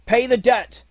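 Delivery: high, loud order to the pack; shouting. A narrator's reading. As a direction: yell for vengeance. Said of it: "shouted Mowgli".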